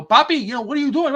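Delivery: put-on high, loud kid's complaint; a young son whining at his father; kid complaining. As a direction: imitate a child calling out his dad. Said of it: "Jake goes".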